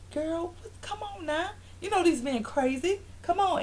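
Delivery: high, soft consoling imitation; mock coaxing. Imitating Phaedra comforting crying Kenya, coaxing. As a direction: gently coax a friend in a small high voice.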